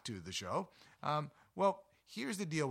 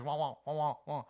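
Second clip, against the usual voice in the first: Charlie Brown adult wah-wah; 2 semitones higher, 5 dB louder than usual. Retold or imitated. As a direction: squawk it like the Charlie Brown teacher. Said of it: "all they're hearing is".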